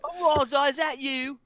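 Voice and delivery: high-pitched barkeep greeting; barkeeper character. Acted. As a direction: greet a patron in a high put-on voice. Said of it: "give me a hard time for using voices".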